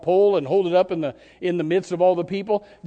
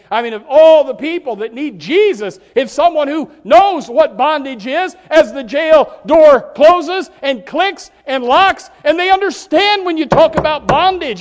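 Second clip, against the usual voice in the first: loud, high, rising preacher's shout; full-force preaching; 9 semitones higher, 10 dB louder than usual. Acted. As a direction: build to a booming pulpit cry. Preaching climax about prison ministry.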